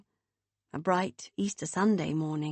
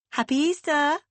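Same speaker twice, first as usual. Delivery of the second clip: bubbly sister voice; 8 semitones higher, 5 dB louder than usual. A narrator's reading. Beth on phone, cheerful greeting.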